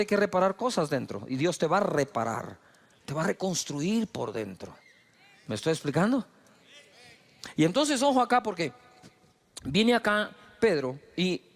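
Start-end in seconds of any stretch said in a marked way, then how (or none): none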